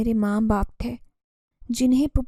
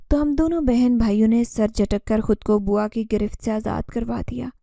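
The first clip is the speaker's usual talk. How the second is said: plain reading voice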